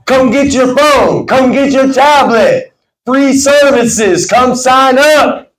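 slow, high, drawn-out sales call; powerful confident pitch. Shown as a demonstration. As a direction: call out the offer to passers-by.

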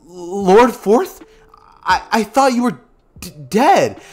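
high, loud disbelief; stunned Naruto. Acted. Naruto seeing the dead Fourth Hokage, high stunned disbelief. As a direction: gasp it out in shock.

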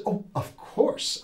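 a light mock of the sister's foreign accent, slow and airy; half-imitate her accent on "Oh, of course", drawn out; imitating sister's accent. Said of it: "I'm not gonna imitate your accent".

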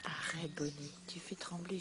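soft whisper to a nervous dog. Real, not acted. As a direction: hush it so she stays calm.

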